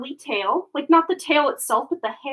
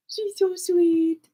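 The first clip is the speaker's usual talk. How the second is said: higher, very slow doting coo; 6 semitones higher, much slower than usual. Real, not acted. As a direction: melt over the puppy. Cooing over the puppy.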